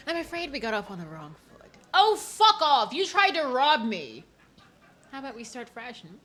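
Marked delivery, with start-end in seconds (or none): 1.9-4.3 loud and high, furious at him; angry outburst. Acted. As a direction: snap back at the thief. Voicing angry character line.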